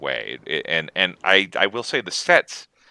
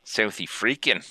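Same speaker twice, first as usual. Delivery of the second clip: South African accent; 7 dB quieter than usual. Shown as a demonstration. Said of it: "I don't know how to do South African accent".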